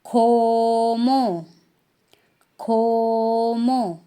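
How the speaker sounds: teacher reviewing Korean "खोमो"; Korean word. Shown as a demonstration. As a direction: model "खोमो" slowly twice. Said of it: "उसको क्या बोलेंगे".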